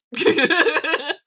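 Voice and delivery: high, loud burst of real laughter; real loud cackling laugh. Real, not acted. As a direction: burst out laughing at your own joke. Laughs at own joke about marker.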